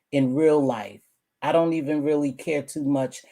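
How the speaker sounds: ordinary talk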